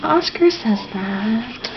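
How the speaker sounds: slow, soft aside; quiet aside. Real, not acted.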